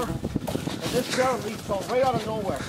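normal talking voice

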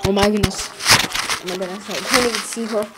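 low, soft murmur; subdued, low-pitched disbelief; hushed shock. Real, not acted. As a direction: say it quietly to yourself. Shocked, looking for someone.

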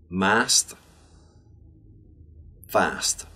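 every S from "Cost" to "fast" a crisp voiceless sss; word list with pauses, clear hissed S in "books", "crisps", "fast". Shown as a demonstration. Teacher giving words to repeat after, clear unvoiced S in "Cost" through "fast". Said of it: "words which contain a clear S".

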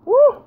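very high loud whoop; excited whoop. Real, not acted. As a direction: cheer out loud. Celebrating a hit.

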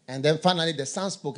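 usual speaking voice

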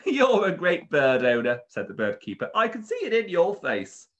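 high, loud cheery shop keeper; beaming bird seller praise. Acted. Bird keeper voiced in story.

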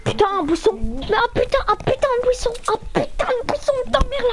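angry. Real, not acted. Raging at a bush.

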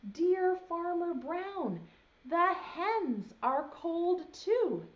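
high, slow letter voice; cows' letter. Acted. Voicing the cows' letter to the farmer, high and slow. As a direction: read the cows' note sweetly.

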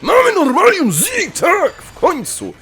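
high, loud victory yell; elated high-pitched celebration; triumphant cheer. Real, not acted. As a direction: shout it out, overjoyed at last. Celebrates long-awaited item.

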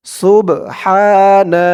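slow model pronunciation; drawn-out recitation. Shown as a demonstration. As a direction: draw out সুবহানা like in prayer. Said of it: "আমি যেখানে যেমন উচ্চারণ করব".

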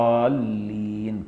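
الضالين slow and quiet, ض close to ظ the Iraqi way; Iraqi reading. Retold or imitated. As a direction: soften the ض in الضالين like an Iraqi imam. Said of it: "صليت ورا عراقيين".

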